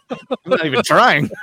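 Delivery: high, loud laughing protest; real laughing. Real, not acted. Host teased by co-host, laughing protest. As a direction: protest through your own laughter.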